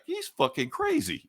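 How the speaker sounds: high, slow squeaky voice; high-pitched mock onlooker; mock bystander. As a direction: play a shocked bystander way up high. Bystanders react in retold scene.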